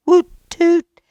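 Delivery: high loud horn toots; party horns. A narrator's reading. Party horn sound effect.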